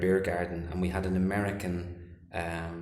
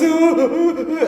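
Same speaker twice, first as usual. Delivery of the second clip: very high, loud mock howls; hammed-up wailing with a laugh; retold cries of pain, played for comedy; imitated howling; 12 dB louder, much slower than usual. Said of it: "she's mimicking me".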